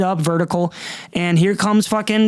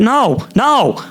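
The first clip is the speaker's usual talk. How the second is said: indignant; 7 semitones higher, 4 dB louder, slower than usual. Real, not acted. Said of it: "I'm a person, and I'm not eighty-five".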